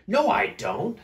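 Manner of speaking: loud, high storybook refusal; Big Smelly Bear. Acted. Smelly bear arguing back, loud high denial. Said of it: "said Big Smelly Bear".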